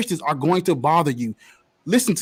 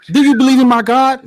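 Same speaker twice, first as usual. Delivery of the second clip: menacing demand; 5 semitones higher, 7 dB louder than usual. Retold or imitated. Acting out killers demanding faith.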